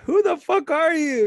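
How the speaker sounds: mock exasperated yell. Friend riffs on exasperated quote.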